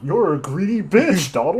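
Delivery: mock scolding. Real, not acted. Mocking duck at the screen.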